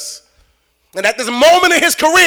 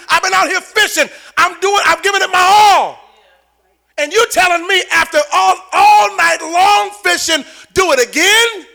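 frustrated fisherman rising to a higher, louder complaint; frustrated Peter; 7 semitones higher, 4 dB louder than usual. Acted. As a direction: vent like a worn-out fisherman who has had enough. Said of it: "he's frustrated".

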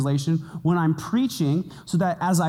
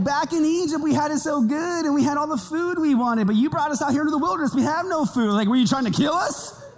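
high, fast mock whining with a laugh; whiny complaining Israelites; 9 semitones higher than usual. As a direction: mimic the grumbling crowd, pitched up. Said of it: "They're like".

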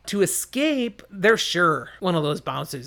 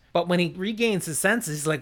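ordinary talk, nothing marked

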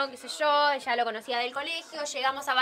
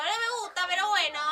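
grudging acceptance; 5 semitones higher than usual. Shown as a demonstration. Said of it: "Cerrás el orto".

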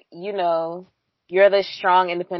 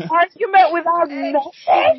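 incredulous laughing yell; 9 semitones higher, 4 dB louder than usual. Real, not acted. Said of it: "Hot chocolate".

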